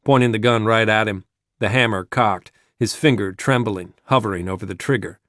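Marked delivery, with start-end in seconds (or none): none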